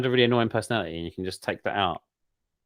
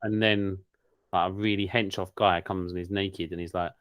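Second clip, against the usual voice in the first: ordinary talk, nothing marked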